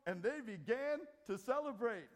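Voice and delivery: high, loud, ringing pulpit proclamation; preaching at full force. Acted. Preacher to congregation, full-force preaching. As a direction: proclaim it like the climax of the sermon.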